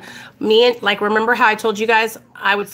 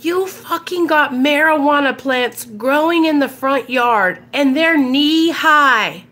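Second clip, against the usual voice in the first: pissed-off dad; 6 semitones higher than usual. Retold or imitated. Dad accusing daughter over plants in the yard, pissed off. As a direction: imitate a furious father laying out the accusation. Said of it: "He was pissed".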